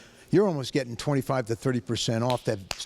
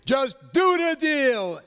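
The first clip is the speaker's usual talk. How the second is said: slow, drawn-out shout, much higher and louder; catchphrase shout; 11 semitones higher, 4 dB louder, much slower than usual. Acted. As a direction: bellow the slogan like a pitchman. Host asks for his catchphrase.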